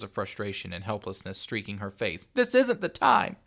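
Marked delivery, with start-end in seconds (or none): 2.3-3.4 slow, high, loud protest; frustrated mother screaming through despair; desperate scream. A narrator's reading. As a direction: scream it to cut the child off. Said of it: "Tanya screamed, desperate tears".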